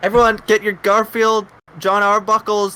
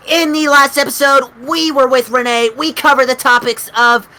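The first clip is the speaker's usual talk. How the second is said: normal talking voice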